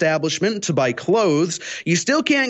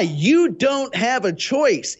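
slow, higher-pitched lofty pronouncement; 6 semitones higher, much slower than usual. Retold or imitated. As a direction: decree it slowly, from above. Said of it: "tells us from on high".

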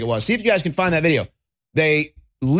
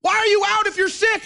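high, frantic put-on hysteria; mock basket case; 13 semitones higher than usual. Host turning on a masked stranger, mock hysteria. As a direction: fall apart in exaggerated panic. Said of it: "become like a basket case".

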